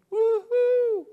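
very high, loud cheer; joyful cheer at a trusting child; excited whoop. Acted. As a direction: whoop with delight. Celebrating God's delight.

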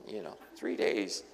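soft whispered aside; whispering. Real, not acted. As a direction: drop to a whisper.

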